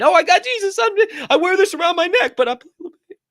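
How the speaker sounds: high, far-off, silly voice of a half-hearted believer; mocking fake believer. Preacher mocks a lukewarm believer, high mocking voice. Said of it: "you play a little bit here".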